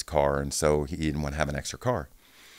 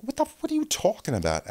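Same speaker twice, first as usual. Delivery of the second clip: confused; faster than usual. Retold or imitated. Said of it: "You're like".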